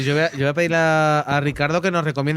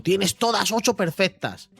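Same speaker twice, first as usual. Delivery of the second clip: higher, slowed-down confident claim; mock assurance; 6 semitones higher, slower than usual. Teacher quoting the AI's false answer about syllables, mock assurance. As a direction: imitate the AI announcing a false result. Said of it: "Y me, me engañó. Me dijo".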